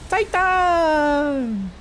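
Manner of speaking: high, drawn-out announcer shout; announcer call. Pretend. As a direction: boom it like a title card. Game title card read out.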